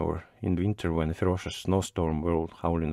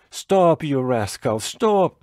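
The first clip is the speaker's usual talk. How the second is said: high-pitched, raised shout; 6 semitones higher, 4 dB louder, much slower than usual. A narrator's reading. Thrown-out postman shouts to driver from the forest. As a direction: shout after someone, pitch leaping up. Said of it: "heard him shout from the forest".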